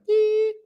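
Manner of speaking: a high sung beep like an answering machine; imitated beep. Imitating the phone's voicemail beep, high and short.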